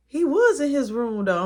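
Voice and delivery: can't believe it; disbelieving protest. Retold or imitated. Friend disbelieving.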